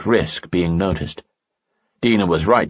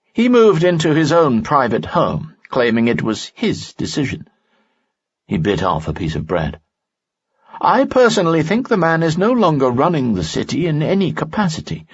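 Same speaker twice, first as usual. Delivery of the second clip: higher, wry friend; Lanson, higher voice; 8 semitones higher than usual. A narrator's reading. Friend tells Lee about the governor, higher voice. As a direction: say it with dry suspicion.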